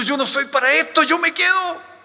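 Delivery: high-pitched, loud-edged voice of someone doubting; acted wavering. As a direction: waver aloud, pitched high, as one giving up. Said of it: "está temblando, está vacilando, está diciendo". (vacilación actuada, voz aguda)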